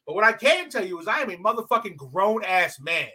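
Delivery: normal talking voice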